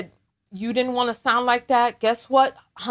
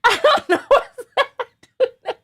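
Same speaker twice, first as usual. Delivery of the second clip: high, helpless laughing speech; talking through laughter; 9 semitones higher, 5 dB louder than usual. Real, not acted. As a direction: try to speak while cracking up. Cannot stop laughing while talking.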